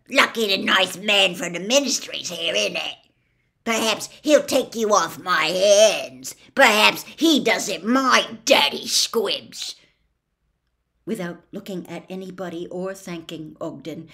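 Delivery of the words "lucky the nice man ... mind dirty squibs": loud, sneering jeer, slowing at the end; mock her with nasty sarcasm. A narrator's reading. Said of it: "he jeered at his daughter".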